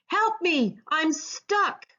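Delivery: high, pleading cry; calling for help. A narrator's reading. Stuck crab calls again for help, high and pleading. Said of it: "King called again".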